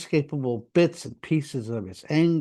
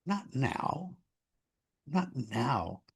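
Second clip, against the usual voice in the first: soft, low brush-off; acted hushed dismissal; quiet retold refusal; 5 semitones lower, 9 dB quieter, much slower than usual. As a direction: put him off quietly, voice sinking on the repeat. Retold refusal to angry man.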